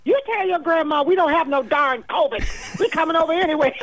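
sassy daughter brushing off the worry; lightly voiced daughter insisting they're coming; imitating daughter. Daughter's defiant reply quoted.

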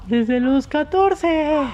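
high, slow put-on girl voice; girly falsetto voice. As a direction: answer in a high, slow girlish voice. Plays girl in mock interview.

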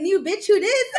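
sassy quote through laughter; laughing while quoting. Retold or imitated.

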